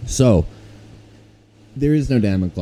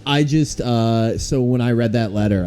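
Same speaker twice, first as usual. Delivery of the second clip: ordinary delivery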